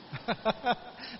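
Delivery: chuckling at your own bad luck; real, amused laugh to yourself; rueful laugh. Real, not acted. Laughs at losing everything. (cười tự giễu)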